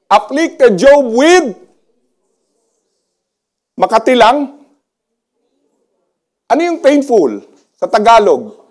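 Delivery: loud, high, driving preacher's call to the crowd; rally the whole hall at full voice; full-force preaching. Acted. Pastor challenging the congregation, loud and fervent.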